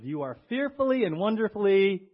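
declaring the birthday verse to the church; proclaimed verse. Acted. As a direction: preach it out loud and high. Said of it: "quoting the verse with force".

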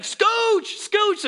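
high, loud shouted command; guide's shout. Retold or imitated. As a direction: bark it like a rafting guide calling out. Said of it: "He's like".

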